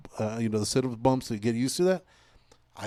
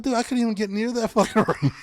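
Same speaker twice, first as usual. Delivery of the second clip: laughing amazement; 11 semitones higher, 3 dB louder than usual. Retold or imitated.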